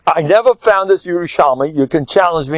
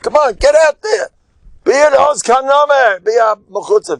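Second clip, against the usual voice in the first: rallying call; 11 semitones higher than usual. Retold or imitated. Rabbi's chevra urging everyone to speak, high rallying cheer. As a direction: push them out the door to speak up. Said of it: "putting out".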